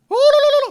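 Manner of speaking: loud, very high trilled gibberish; mock chanting babble. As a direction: trill nonsense syllables as a hammed-up blessing. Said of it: "이렇게 해준 거예요".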